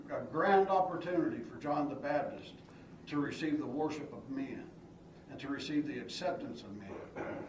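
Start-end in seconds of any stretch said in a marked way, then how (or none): none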